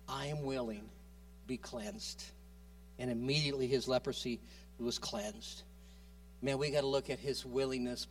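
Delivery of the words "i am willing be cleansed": gentle, soft reply. A narrator's reading.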